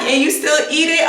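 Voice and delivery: surprised. Retold or imitated. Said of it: "She was like".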